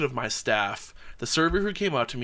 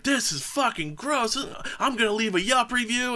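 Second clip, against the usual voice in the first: mock outraged complaint; 10 semitones higher than usual. Angry diner threatening the manager with a review. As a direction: sneer it like an entitled customer. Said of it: "making a big scene".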